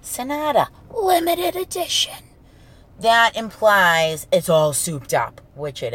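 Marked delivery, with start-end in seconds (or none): 0.0-2.2 "Sonata limited edition" lifted high, like a proud car ad; showy model name. Pretend. Answering friends who ask about her new car, a proud showy lift. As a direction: announce "Sonata limited edition" as if it's luxury. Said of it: "I'm gonna be like".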